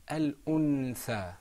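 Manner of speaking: "Al-untha" slowly, with an audible n; "Al-untha", slow and clear, n sounded; without ikhfa. Shown as a demonstration. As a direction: let them hear the noon in "Al-untha". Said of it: "Can you hear that noon".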